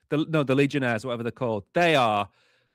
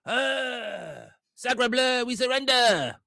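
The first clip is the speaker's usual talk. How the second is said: higher, hammed-up French accent, giving up at once; mock French accent; 9 semitones higher, much slower than usual. Mocking the French army for his co-host, in a higher hammed-up French accent. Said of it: "The French would literally go".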